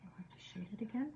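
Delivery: quick, soft whisper to herself; fast whispered self-talk over the brush. Real, not acted. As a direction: rush it out under the breath. Whispering to herself while painting.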